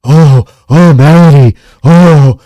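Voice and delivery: hammed-up moans of pleasure; imitated moaning. [name] reacting to Melody's touch, mock moaning. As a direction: draw out each oh in mock ecstasy.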